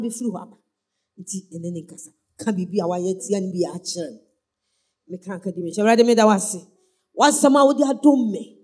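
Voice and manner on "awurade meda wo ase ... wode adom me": preacher praying aloud for the congregation; fervent prayer. Acted. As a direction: pray aloud leading the church.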